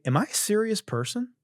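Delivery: a hint of a self-searching viewer; mock self-questioning. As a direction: ask yourself like a solemn doubter. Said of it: "you're gonna say".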